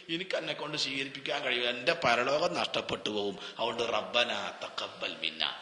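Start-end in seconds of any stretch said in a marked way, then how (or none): 3.8-5.5 slow, much lower and softer റബ്ബനാ തഖബ്ബൽ മിന്നാ, leaning on റബ്ബനാ; hushed humble prayer. Shown as a demonstration. Preacher models a humble plea to God, slow and hushed. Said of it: "പടച്ചോനെ ആലോചിക്ക്".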